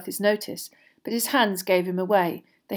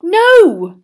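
high, loud, emphatic denial; vehement; 11 semitones higher, 15 dB louder than usual. A narrator's reading. Boy denying he asked an older student, vehemently. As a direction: burst out with it forcefully. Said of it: "said Harry vehemently".

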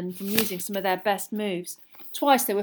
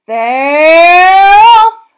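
loud high scream of excitement; 10 semitones higher, 21 dB louder than usual. A narrator's reading. Bagman screams to the crowd that the match has begun. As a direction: yell the start to the stadium. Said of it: "screamed Bagman".

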